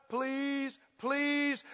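soft, drawn-out mock pleading; meek begging, please after please; groveling plea. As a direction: imitate a fawning beggar. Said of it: "they grovel and they fawn and they crouch".

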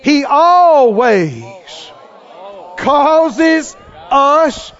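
loud, high preacher's cry, drawn out; full-force preaching. Acted. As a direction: preach it hard to the crowd.

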